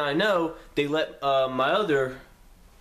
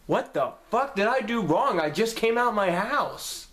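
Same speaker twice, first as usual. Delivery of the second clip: indignant; 7 semitones higher than usual. Retold or imitated. Handcuffed teen pleads innocence.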